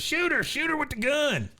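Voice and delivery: very high, frantic character cry; mock panicked urging. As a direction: egg him on in a squealed mock panic. Dale urges boy to shoot.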